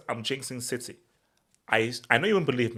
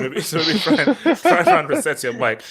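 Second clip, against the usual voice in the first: laughing; 4 semitones higher, 10 dB louder than usual. Real, not acted. Laughing at friend's robotic mic.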